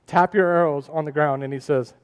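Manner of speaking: hushed preacher narrating Kings. Acted. Quiet retelling of the arrows.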